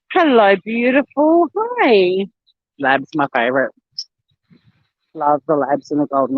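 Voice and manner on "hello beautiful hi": higher, slow, cooing hello. Real, not acted. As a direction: coo at a sweet dog. Greeting a passing dog.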